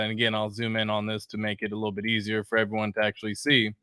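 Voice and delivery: ordinary delivery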